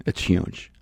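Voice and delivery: "It's huge" breathed as a low whisper. Acted. Preacher confiding to listeners, whispered "It's huge". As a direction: drop to a hushed whisper on "huge".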